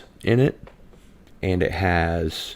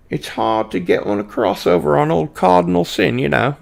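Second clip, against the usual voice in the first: lightly put-on accent, jokingly called Austrian, pitched higher; a playful half-British, half-Austrian lilt, pitched up; casual mock accent, raised pitch; 8 semitones higher, 4 dB louder than usual. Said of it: "That was an excellent Austrian accent you just did".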